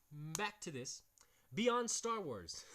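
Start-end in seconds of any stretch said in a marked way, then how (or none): 1.5-2.6 amused announcing. Real, not acted. Reading a funny book title.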